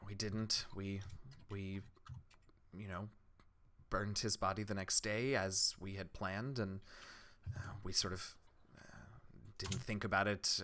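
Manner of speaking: very soft and low, with halting pauses; subdued, hesitant villager; grieving villager. Acted. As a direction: recall it reluctantly, quietly, searching for words.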